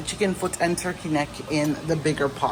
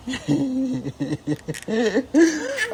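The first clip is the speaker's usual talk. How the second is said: giggling laugh; 10 semitones higher than usual. Real, not acted. Laughing with baby.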